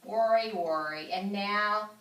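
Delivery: usual speaking voice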